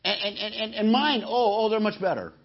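high-pitched, dismissive and boastful; cocky know-it-all. Retold or imitated. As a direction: brush them off like a show-off. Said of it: "and I say".

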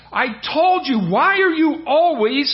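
furious. Retold or imitated. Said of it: "Ahab just starts getting furious".

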